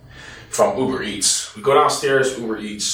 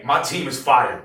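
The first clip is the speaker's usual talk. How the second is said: ordinary talk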